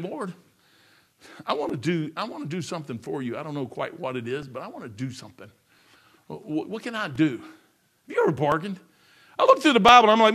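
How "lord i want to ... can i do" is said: soft, lower, humble plea to the Lord; preacher voicing a quiet, searching prayer; hushed prayer. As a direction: pray it almost under your breath.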